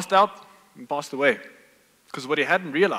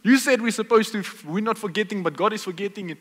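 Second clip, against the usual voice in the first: imitating a protesting listener, higher and louder then settling; a hammed-up objection from the pews; mock yell; 7 semitones higher, 4 dB louder than usual. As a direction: congregation member accusing the pastor, mock yelled. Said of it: "Now you're yelling at me".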